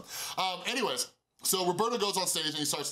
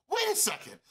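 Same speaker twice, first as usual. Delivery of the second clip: much higher, startled; sudden realization. Retold or imitated. Recognizing the Free Hugs guy, startled and high. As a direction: catch yourself recognizing him.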